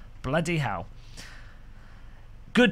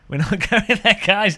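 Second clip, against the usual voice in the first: higher, laughing refusal; 5 semitones higher, 4 dB louder than usual. Real, not acted. Streamer telling viewers we're not going there, laughing. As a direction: laugh while waving the topic away. Laughing off an accidental rude word.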